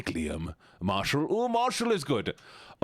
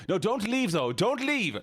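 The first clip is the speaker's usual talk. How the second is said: mock plea; 12 semitones higher, slower than usual. Pleading viewer not to leave.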